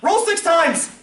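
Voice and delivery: excited yelling. Real, not acted. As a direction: call out instructions, high and excited. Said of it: "Sorry, I'm yelling".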